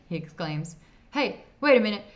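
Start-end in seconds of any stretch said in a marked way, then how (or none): 1.1-2.1 loud, high outburst; alarmed accusation. A narrator's reading. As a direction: burst out as the mistake dawns. Man accuses clerk in story.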